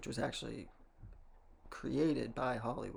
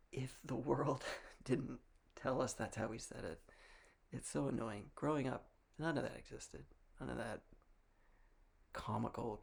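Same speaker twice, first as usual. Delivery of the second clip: normal talking voice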